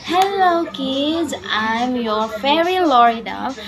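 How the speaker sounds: usual speaking voice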